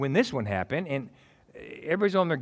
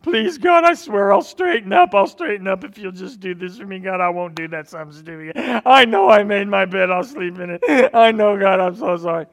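high loud pleading whine; hammed-up begging; 5 semitones higher, 9 dB louder than usual. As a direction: beg God like a desperate leper. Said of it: "humbly begged and pleaded".

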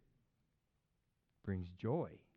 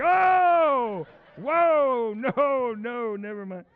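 loud, high, drawn-out horrified wail; hammed-up scandalized cry; mock shock; 8 semitones higher, 12 dB louder, much slower than usual. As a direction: recoil loudly, then back off. Acts shock on hearing of prostitute.

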